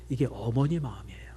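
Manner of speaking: hushed, whispered teaching point. Real, not acted. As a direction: draw them in with a whisper. Intimate point in sermon. (조용히 속삭이며 가르치듯)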